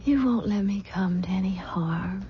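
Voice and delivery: hushed, trusting whisper; whispered plea to her husband for safety; pleading whisper. Acted. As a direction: breathe it to him as you drift off. Pleading as she falls asleep.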